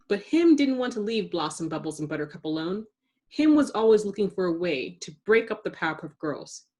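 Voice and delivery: ordinary delivery, nothing marked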